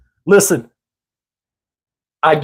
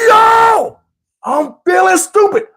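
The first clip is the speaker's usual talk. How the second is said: hooting roast; 12 semitones higher, slower than usual. Retold or imitated. Kids laughing at Steven on the ground, high and hooting. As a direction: crack up while roasting him.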